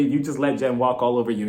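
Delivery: usual speaking voice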